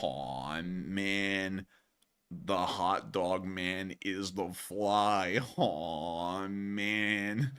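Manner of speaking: low, soft, slow groaning letdown; a hammed-up disappointed moan; mock dejected. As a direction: sigh it out in mock despair. Lament over the hot dog man.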